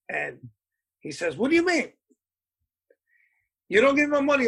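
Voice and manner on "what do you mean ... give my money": much higher, mock outraged bandleader; retold indignation. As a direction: argue back as Hector, pitched way up. Bandleader arguing with club owner.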